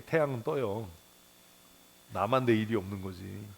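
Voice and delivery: ordinary talk, nothing marked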